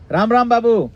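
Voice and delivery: loud, high hearty call-out; village greeting. Acted. Villager greeting another villager, called out loud and high. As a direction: hail a neighbour across the village. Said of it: "that's how we greet each other in the villages".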